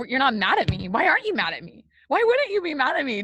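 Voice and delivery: overwrought, pleading questions rising in pitch; spiralling disbelief. Retold or imitated. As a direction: voice climbing high in disbelief. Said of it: "sitting there in this negative spiral".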